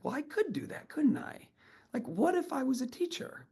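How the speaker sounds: high, soft wondering whisper; hushed realisation. Retold or imitated. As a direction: breathe it out as a dawning idea. Realising he could teach.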